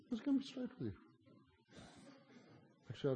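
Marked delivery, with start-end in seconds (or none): 0.1-0.9 excited high aside. Real, not acted.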